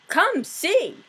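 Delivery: high, eager come-see; excited call. Acted. Husband calling wife to the door, high and eager. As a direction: call her over, pitch up.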